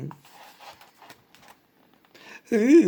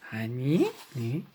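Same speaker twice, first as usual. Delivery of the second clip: ordinary delivery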